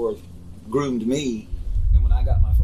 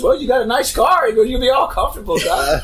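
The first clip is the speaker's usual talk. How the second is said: mock boss voice, much higher and louder; teasing boss; 11 semitones higher, 5 dB louder than usual. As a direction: rib him like a joking boss, pitched way up. Boss quoted teasing about car.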